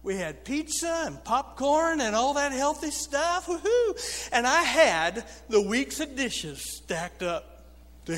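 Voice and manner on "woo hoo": high cheerful woo-hoo, pitched way up. Real, not acted. Cheering over pizza night.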